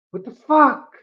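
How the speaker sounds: re-enacted shock, high and loud; shocked outburst. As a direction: relive a startled, indignant cry. Reliving sudden hand snap at him.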